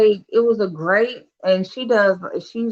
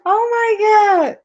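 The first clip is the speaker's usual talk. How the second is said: surprised shout; 5 dB louder than usual. Real, not acted.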